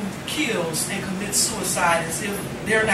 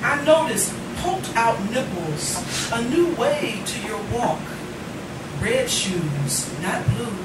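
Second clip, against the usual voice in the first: poem recitation; 3 semitones lower, slower than usual. Acted. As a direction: read it tenderly to the room. Recites poem about student's dad.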